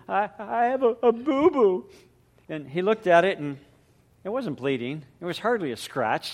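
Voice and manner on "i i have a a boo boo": put-on high, louder little-girl voice; a small child whimpering about her hurt finger; sobbing little girl. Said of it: "she came up sobbing".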